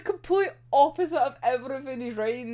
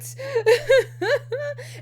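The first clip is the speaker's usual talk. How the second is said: high teary laugh; laughing sob; 11 semitones higher, 4 dB louder than usual. Real, not acted. Fan overwhelmed by the sad scene, teary laugh. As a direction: laugh and cry at once.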